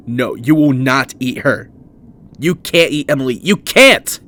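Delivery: building to a high, loud scream; panicked, near-hysterical shouting. Acted. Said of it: "Ralph screamed, feeling almost hysterical".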